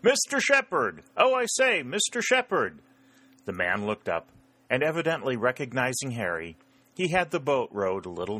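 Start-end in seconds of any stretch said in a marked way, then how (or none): 0.0-2.8 high-pitched call across the water; shouted hail. A narrator's reading. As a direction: call out loudly to someone in another boat, voice pitched way up. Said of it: "he shouted".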